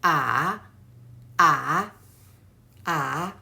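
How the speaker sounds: "Ah" dipping low then rising back up, low voice; third tone demo. Shown as a demonstration. Teacher showing learners the dipping third tone "Ah". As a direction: let each "Ah" fall to the bottom and turn up. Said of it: "The tone".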